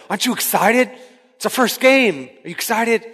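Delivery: high, eager pep talk; excited coach. Retold or imitated. Coach to boy on the bench at the first game, excited.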